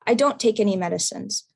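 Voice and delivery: ordinary talk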